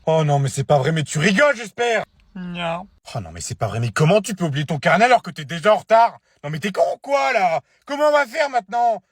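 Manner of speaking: high, loud exasperated yelling; furious scolding. Acted. Mother yells at her son for forgetting his notebook, furious scolding. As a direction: lose it at him completely.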